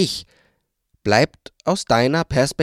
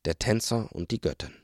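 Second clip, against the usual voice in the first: much softer, hushed words; whispered; 10 dB quieter than usual. A narrator's reading. Waldemar sighs and whispers the story's title. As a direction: breathe it out like a secret. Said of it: "seufzte Waldemar und flüsterte".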